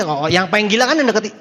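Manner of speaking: normal talking voice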